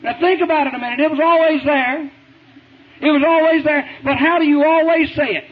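normal talking voice

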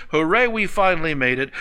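ordinary delivery

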